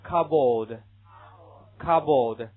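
"cupboard" slow and loud, spoken as CUB-erd; 慢读示范，加重; slow pronunciation demo. Shown as a demonstration. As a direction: model "cupboard" to repeat. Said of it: "这个".

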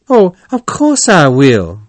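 high, loud, eager agreement; eager cry. A narrator's reading. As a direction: jump at the offer. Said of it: "cried Toad".

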